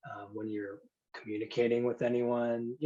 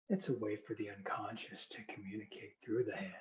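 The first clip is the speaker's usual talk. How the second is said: hypnosis induction; 6 dB quieter than usual. Acted. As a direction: guide them gently into trance.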